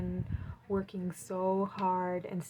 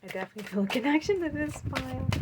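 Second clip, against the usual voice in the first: fast, higher and louder gush; excited rush; 6 semitones higher, 6 dB louder, much faster than usual. Real, not acted. Reader telling pile three she feels connected, excited and fast. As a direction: pour it out quickly, buzzing with excitement.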